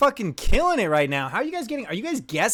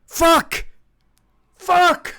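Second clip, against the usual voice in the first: sharp, loud, much higher curse; angry shout; 11 semitones higher, 6 dB louder than usual. Real, not acted. Streamer cursing the game after a wrong answer, loud yell. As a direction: bellow the curse.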